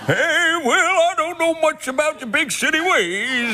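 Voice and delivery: put-on old Southern lawyer. Showing off the only American accent he can do, a Southern lawyer. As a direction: drawl like a folksy old country lawyer. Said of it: "old Southern lawyer".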